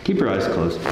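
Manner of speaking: ordinary talk, nothing marked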